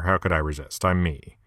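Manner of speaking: usual speaking voice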